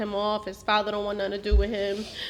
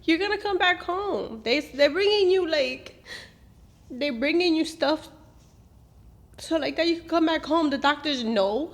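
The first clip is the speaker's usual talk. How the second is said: higher, bright upbeat reassurance; cheerful reassurance; 8 semitones higher, 4 dB louder than usual. Retold or imitated. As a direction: sound cheerful to keep his hopes up. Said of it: "I said".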